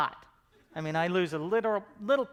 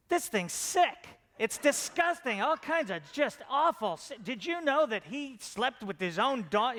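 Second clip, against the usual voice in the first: higher-pitched, slow mock disgust; acted outrage, pitched up; appalled dad; 6 semitones higher than usual. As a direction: imitate a scandalized father, stretching the shocked words. Imitating his appalled dad.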